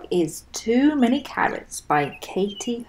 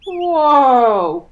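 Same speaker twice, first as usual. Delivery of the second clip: loud, higher alarmed whoa; groaning whoa; 6 semitones higher, 9 dB louder than usual. Acted. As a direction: wobble on a swaying branch. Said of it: "groaned Tortoise and Bird".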